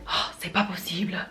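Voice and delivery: sighed French exasperation; exasperated Parisian. Acted. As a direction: let out a fed-up puff of breath, then the complaint. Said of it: "a Parisian will just say".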